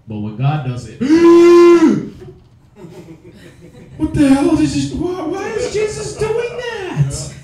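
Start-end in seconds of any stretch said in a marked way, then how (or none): 1.1-2.3 loud, high hammed-up gasp; theatrical gasp of fake horror; mock shocked gasp. Mock horror at God's act. 4.0-7.3 high, hammed-up scandalized voice; mock horror, slow and high on the second line; mock shock. Mocking shocked believers.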